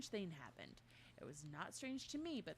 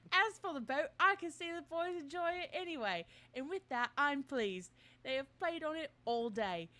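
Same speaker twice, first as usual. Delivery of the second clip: put-on higher, louder British accent reading; 5 semitones higher, 7 dB louder than usual. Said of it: "It was about to go Cockney immediately".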